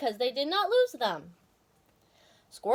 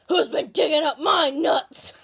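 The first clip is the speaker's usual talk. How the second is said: louder, slow angry crowd shout; squirrels shouting; 5 semitones higher, 7 dB louder than usual. A narrator's reading. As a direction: bellow it as an angry mob. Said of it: "a crowd of squirrels shouting".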